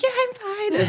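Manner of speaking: high, strained squeak while holding breath; imitating a choked-up reply, very high; mock choking. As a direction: squeeze it out as if choking. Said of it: "I was, like, choking myself".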